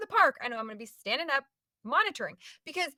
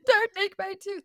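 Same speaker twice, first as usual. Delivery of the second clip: higher-pitched playful plea; hammed-up begging; mock pleading; 8 semitones higher, 4 dB louder than usual. Patient begging the dentist for her tooth, mock pleading.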